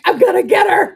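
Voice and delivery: loud, laughing mock vengeance; vengeful growl. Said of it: "I wasn't like".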